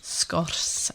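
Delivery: Sgwrs whispered with a Scottish roll; Sgwrs, hushed, rolled like Scottish; Scottish-sounding. Shown as a demonstration. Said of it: "came out a bit Scottish".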